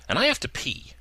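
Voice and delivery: high petulant whine; Gina, hissing. A narrator's reading. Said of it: "Gina hissed".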